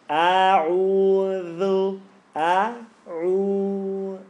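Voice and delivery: "A'udhu" stretched out very slowly; slow motion. Shown as a demonstration. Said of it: "let me do it in slow motion".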